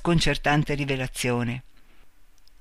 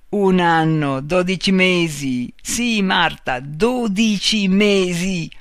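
"dodici mesi" drawn out, slow and louder; emphatic revelation; 3 semitones higher, 6 dB louder, slower than usual. A narrator's reading. Man taunts Marta, "dodici mesi" slow and emphatic. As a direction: hammer home "dodici mesi", stretching each word.

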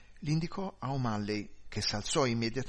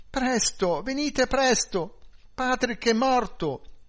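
high, loud, drawn-out cry for help; frantic shout; 12 semitones higher, 7 dB louder, slower than usual. A narrator's reading. Wife phones police about dead husband, shouting high and desperate. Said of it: "gridò".

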